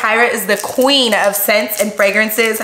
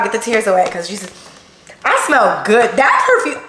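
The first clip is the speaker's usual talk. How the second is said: ordinary talk, nothing marked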